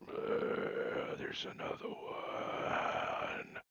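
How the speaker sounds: slow, drawn-out ghostly whisper; eerie whisper. Acted. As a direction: whisper it very slowly, stretching the words. Game master voices the creeping killer.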